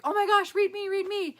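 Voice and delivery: high, loud imitation of an eager fan; acted-out squeal of pleading; excited begging. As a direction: beg for it, bursting with excitement. Imitates a girl begging for a reading.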